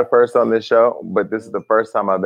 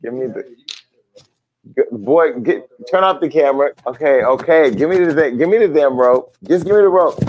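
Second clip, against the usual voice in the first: laughing demand; 5 semitones higher than usual. Real, not acted. Demanding robe amid laughter.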